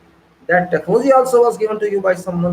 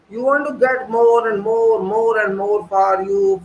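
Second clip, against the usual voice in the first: ordinary talk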